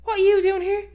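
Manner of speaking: imitating dad's loud, very high squeal; mock falsetto astonishment; voice shooting up octaves. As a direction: squeak it out in high-pitched disbelief. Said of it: "his voice goes up about ten octaves".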